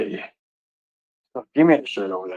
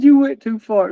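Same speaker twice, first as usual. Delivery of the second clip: high, mocking parrot of the quote; mocking the guy in the clip; 10 semitones higher, 3 dB louder than usual. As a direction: echo him in a squeaky mock voice. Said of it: "he said".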